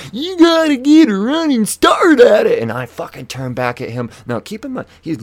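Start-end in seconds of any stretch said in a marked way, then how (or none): 0.1-2.6 high, very loud imitated holler; screaming old man. Old man screams advice down the block, in loud holler. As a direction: holler it from half a block away. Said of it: "So he screams at me".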